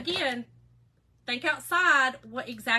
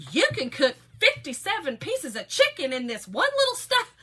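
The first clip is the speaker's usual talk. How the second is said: high, loud late-night TV sell; infomercial pitch; 5 semitones higher, 7 dB louder than usual. Retold or imitated. Late-night show selling a cooker, loud infomercial pitch. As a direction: hype it like a cheesy infomercial host. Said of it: "I really sound like an infomercial".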